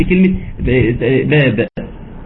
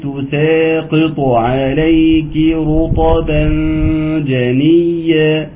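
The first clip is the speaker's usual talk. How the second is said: slow drawn-out tajweed chant; Quran recitation; 2 semitones higher, much slower than usual. Shown as a demonstration. Teacher reciting Sura Maryam to students, slow chanted recitation. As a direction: recite slowly, stretching the vowels. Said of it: "ثم الآية الأخيرة".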